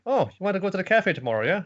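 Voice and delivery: put-on light teasing girlish ask; cheerful girl voice. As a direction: sweetly offer the outing. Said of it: "Nodoka's like".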